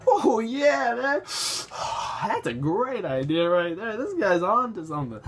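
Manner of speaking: high, loud pothead impression; hazy mock enthusiasm with a big exhale; second stoned writer. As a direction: play a stoner approving an idea. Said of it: "And the other writer's like".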